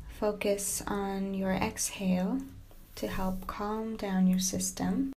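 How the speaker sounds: soft yoga nidra voice with long pauses; guided meditation. Acted. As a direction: gently settle the listener into stillness, speaking softly.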